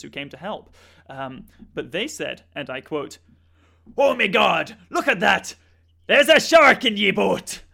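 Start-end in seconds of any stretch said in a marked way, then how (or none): 4.0-4.8 loud, high mock-panicked Australian cry; panicked exclamation. Coast Guard officer seeing the shark, in a loud mock-panicked cry. 4.9-7.6 loud, higher, slowed hammed-up Aussie yell; mock-panic, pointing it out to everyone; panicked Australian shout. Coast Guard officers spotting the shark in the boat, in a hammed-up Australian yell.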